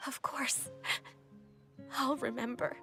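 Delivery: obey while holding back tears; tearful. Acted. Child promising Father to remember the lesson, tearful. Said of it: "Dry your tears".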